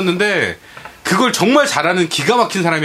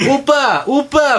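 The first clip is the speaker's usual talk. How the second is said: higher-pitched mock "oppa"; imitating Taiwanese players; 7 semitones higher, much slower than usual. Gamer retelling Taiwanese teammates' teasing second words, mocking imitation. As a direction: parrot teasing foreigners calling out, playfully high. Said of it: "막 이러는 거야".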